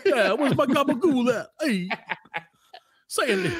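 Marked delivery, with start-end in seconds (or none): 0.0-1.5 high, laughing New Jersey Italian; Italian-American accent. Pretend. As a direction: ham up a Jersey mobster. Mock Italian-American gangster.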